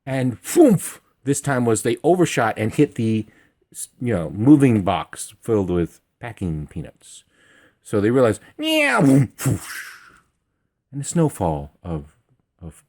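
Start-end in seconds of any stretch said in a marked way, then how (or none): 0.4-1.0 higher, louder mock landing thud; imitated foomf of a landing; thump noise. Cat landing in packing box. 8.6-9.0 much higher squeaky mock meow; high cartoon cat meow; imitated meow. Cat realizing mid-jump.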